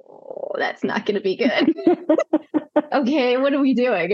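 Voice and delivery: retold reaction said through laughter; laughing through it. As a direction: laugh while quoting yourself. Retold reaction to meeting wife.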